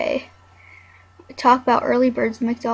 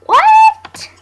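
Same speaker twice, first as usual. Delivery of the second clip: sudden squeal, much higher and louder; shocked shriek; 11 dB louder than usual. Real, not acted. Reacting to something in the game, high shocked squeal. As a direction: shriek it in disbelief.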